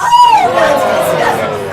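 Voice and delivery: loud, high cry of disgust; disgusted shout. Acted. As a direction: recoil loudly in revulsion. Reacting to toad stomachs.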